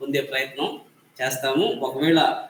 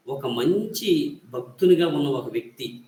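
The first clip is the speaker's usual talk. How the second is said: usual speaking voice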